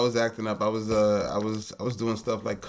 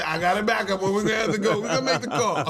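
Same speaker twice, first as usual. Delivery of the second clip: higher-pitched mock father, breaking into laughter; amused imitation; 9 semitones higher, 4 dB louder than usual. Son imitates dad deciding to send him away, laughing. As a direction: play a parent's plotting through laughter.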